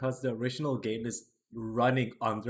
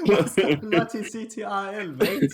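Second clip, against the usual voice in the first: louder, higher mock British accent through laughter; laughing British accent; 8 semitones higher, 8 dB louder than usual. Echoing a friend's joke in a laughing British accent. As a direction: parrot the joke in a British accent while cracking up. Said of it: "exaggerated British accent".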